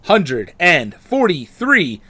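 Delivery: big booming show opener; announcer intro. Acted. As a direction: welcome listeners like a radio host. Podcast host opening the episode.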